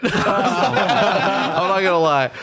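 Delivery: laughing through the words. Real, not acted. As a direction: giggle while saying it. Laughing while talking.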